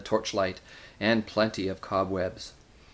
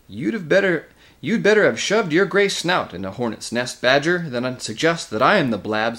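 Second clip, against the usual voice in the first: loud, higher-pitched heated rebuke; angry retort; 5 semitones higher, 5 dB louder than usual. A narrator's reading. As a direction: round on your accuser. Nickabrick rounds on the badger, quarrelling.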